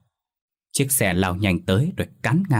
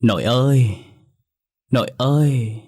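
put-on high piercing girl's voice from the boy; shrill little girl voice; 4 semitones higher, 4 dB louder than usual. A narrator's reading. Possessed boy calls his grandmother in a little girl's voice. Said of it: "Âm thanh lanh lảnh từ miệng thằng Phát vang lên".

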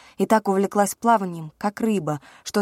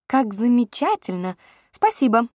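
cartoon princess; 5 semitones higher, 4 dB louder than usual. A narrator's reading. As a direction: gush like a carefree princess. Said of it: "захихикала, как беззаботная принцесса".

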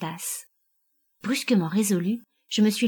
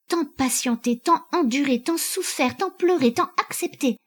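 mounting anguish; 6 semitones higher than usual. A narrator's reading. Wife recounting years of endurance to her husband, mounting anguish. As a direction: list climbing steadily in pitch. Wife's mounting list of sufferings.

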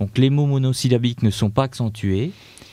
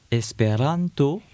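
espéranto said slowly, weight on ran; stress on the second-last syllable; 2 semitones higher, slower than usual. Shown as a demonstration. Teacher demonstrating Esperanto stress, slow stressed espéranto. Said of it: "Par exemple, le mot".

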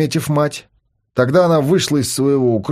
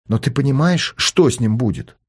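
usual speaking voice

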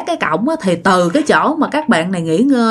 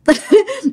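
short high-pitched real giggle; stifled giggle; 12 semitones higher than usual. Real, not acted. Storyteller unable to get past the slip, high giggle. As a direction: let a laugh escape while resuming.

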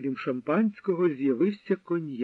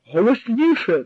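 higher, louder command; loud order; 6 semitones higher, 6 dB louder than usual. A narrator's reading. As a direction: demand it louder. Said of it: "наказав гестаповець".

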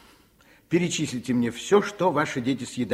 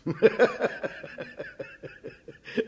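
amused laugh; 9 semitones higher than usual. Acted. Doctor laughs at overfed children.

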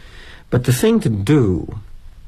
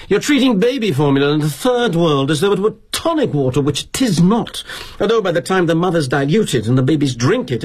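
put-on indignant Brit kid; 6 semitones higher than usual. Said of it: "This Brit kid who works for us".

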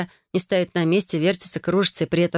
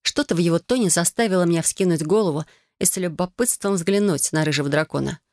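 ordinary delivery

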